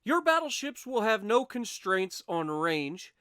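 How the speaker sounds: usual speaking voice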